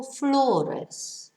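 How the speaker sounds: "flores" as FLO-res, first of two syllables stressed; penultimate stress. Shown as a demonstration. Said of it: "it's stressed on the last but one syllable".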